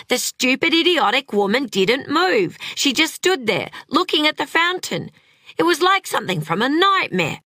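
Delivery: high-pitched, exasperated narration; frantic frustration. A narrator's reading. Frantic that bystander will not move.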